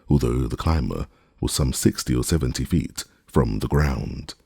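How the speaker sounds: ordinary delivery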